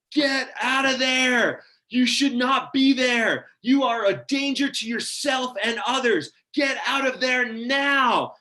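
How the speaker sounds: loud, high, slow, drawn-out shout; lifeguard yelling. Retold or imitated. Lifeguard yelling at surfers over a booming sound system, shouted warning. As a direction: bellow each warning like a booming beach announcement. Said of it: "And he's yelling".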